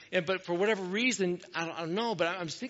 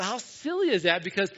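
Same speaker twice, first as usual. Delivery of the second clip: ordinary talk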